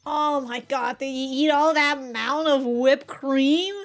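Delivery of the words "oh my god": ordinary delivery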